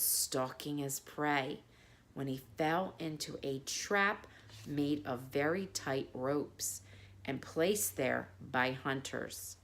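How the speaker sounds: hushed bedtime narration; bedtime story reading. Acted. Reads fable to children.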